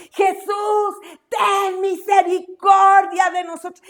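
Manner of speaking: anguished shout to Jesus, drawn out; crying out loudly. Shown as a demonstration. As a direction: call out for mercy at full voice. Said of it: "Pero gritaron a, a gran voz".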